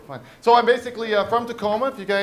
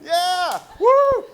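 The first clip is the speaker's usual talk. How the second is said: high whoops climbing to a "woo"; hyped-up cheering; 12 semitones higher, 4 dB louder, much slower than usual. Acted. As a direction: whoop like a fighter working the crowd. High-fiving the crowd, getting excited.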